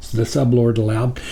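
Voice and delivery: ordinary delivery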